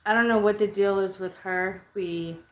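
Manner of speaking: usual speaking voice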